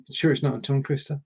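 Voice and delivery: usual speaking voice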